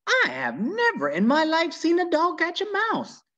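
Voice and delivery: high, wondering lady's voice; amazed old lady. Acted. Miss Nordley marveling to Opal, high and amazed.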